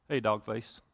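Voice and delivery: low, playful ribbing between buddies; teasing guy greeting. Retold or imitated. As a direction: toss it out as a friendly slam. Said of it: "we do it weird, though".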